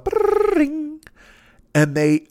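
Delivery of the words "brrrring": put-on high rolled brrrring like an old phone; phone ring imitation. The phone on Sesame Street rings, high rolled trill. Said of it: "the phone rings off".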